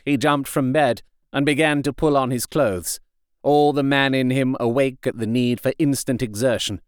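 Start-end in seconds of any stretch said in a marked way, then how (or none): none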